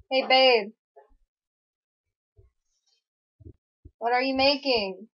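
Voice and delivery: calling out to partner. Real, not acted.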